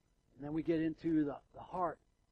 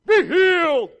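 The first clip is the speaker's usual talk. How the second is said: loud, much higher hammed-up shout; mock faith healer; 14 semitones higher, 17 dB louder, slower than usual. Mocking a Pentecostal healer knocking people down, hammed-up shout. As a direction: bellow it like a showy healer. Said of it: "cessationists ... just saying".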